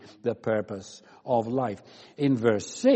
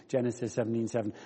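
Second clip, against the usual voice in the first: ordinary delivery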